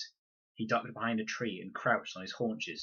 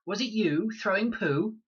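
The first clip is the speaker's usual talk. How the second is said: put-on high, louder little girl's question; child's voice; 12 semitones higher, 7 dB louder than usual. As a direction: ask it like a cross child. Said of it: "the voice was definitely a child's".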